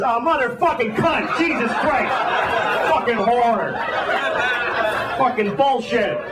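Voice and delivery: high, cursing outburst; high mock rage, half laughing; mock furious preacher. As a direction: swear high like a preacher whose train just died. Preacher swears on hearing announcement.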